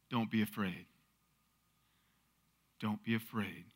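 hushed, low and tender; gentle reassurance. Acted. Elijah comforts the widow facing her last meal, reassuring. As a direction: soothe her very softly, pausing between repeats.

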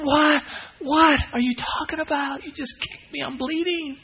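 high-pitched, incredulous mock protest; outraged victim. Kicked listener protesting to the preacher, high mock outrage. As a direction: squeal in hammed-up disbelief.

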